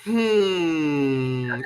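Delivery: long knowing hum; class's smug hmm. Retold or imitated. Imitating the third grade reacting to a mispronunciation. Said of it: "the whole class went".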